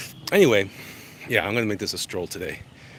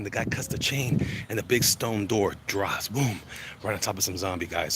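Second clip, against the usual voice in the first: usual speaking voice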